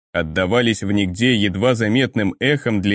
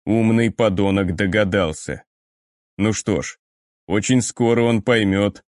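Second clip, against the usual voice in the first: ordinary delivery, nothing marked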